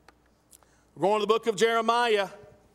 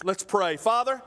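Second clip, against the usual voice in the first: ordinary delivery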